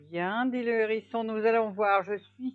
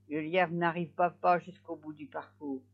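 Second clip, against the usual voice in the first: ordinary delivery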